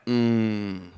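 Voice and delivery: half orgasm, half gospel choir mm; loud appreciative grunt; guttural grunt. Shown as a demonstration. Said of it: "half orgasm, half southern gospel choir".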